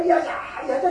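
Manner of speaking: ordinary talk, nothing marked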